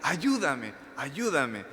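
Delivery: slow, high-pitched plea for help, fading on each ayúdame; mock pleading woman crying out to Jesus. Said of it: "Y sigue gritando".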